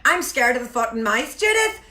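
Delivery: affectionate Belfast impression; Belfast accent. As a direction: put on a Northern Irish accent from Belfast. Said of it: "it's one of my favourite accents in the world".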